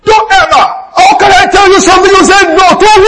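high, loud, fast scolding; retold angry shout. Father reenacting shouting at his daughter Michelle, high and loud. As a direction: let the old anger burst out again. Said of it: "So I shouted".

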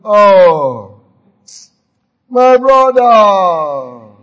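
normal talking voice

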